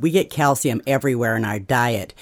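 ordinary talk, nothing marked

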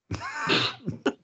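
shrieking high laugh; high squealing laugh. Real, not acted. After Swedish friends' joke, high squealing laugh.

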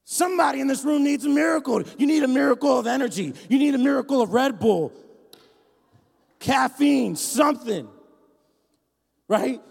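loud, high preacher's shout; preaching at full force. Acted. Pastor to the congregation, shouting with full force. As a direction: fire up the room.

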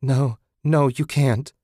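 rising panic over her dead friend; desperate denial. A narrator's reading. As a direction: beg her not to be dead.

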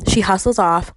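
ordinary delivery

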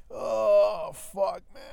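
loud, high frustrated yell of "oh, fuck, man"; exasperated shout. Real, not acted. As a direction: let it burst out, fed up. Exasperated outburst after rant.

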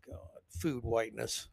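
low, very soft aside; exasperated mutter. Real, not acted. Host reacts to the phrase he just read, low exasperated mutter. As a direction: mutter it under your breath in disbelief.

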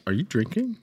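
high-pitched, rising accusatory question; mock suspicion. Passenger accusing the driver who hit them, mock suspicious. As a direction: play at being scandalised by the other driver.

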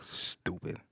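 soft unvoiced "Stupid"; quietly insulting a man with wooden teeth; muttered insult. Real, not acted. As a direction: breathe the word out dismissively.

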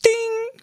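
high ding chime; ding sound. Pretend. Sound effect in story.

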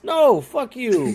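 high, slow, loud outraged "No, fuck you"; indignant retort. Retold or imitated. To the doctor telling him to relax: slow, loud outraged retort. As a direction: snap it back at him, outraged but playing it for laughs.

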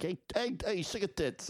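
high squeaky sleazy imp; leering cartoon imp catcall; Impmon flirting. Co-host doing Impmon's crude catcall, high squeaky. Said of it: "He's like".

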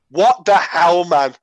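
loud exasperated What the hell; exasperated outburst. Retold or imitated. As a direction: throw it out, fed up with mum. Exasperated at mum.

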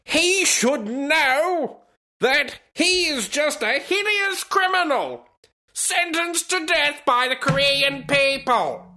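high, slow, hammed-up state propaganda voice; mock North Korean newspaper. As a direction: declaim it high-pitched and slow like a pompous decree. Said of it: "the North Korean newspaper went on to say".

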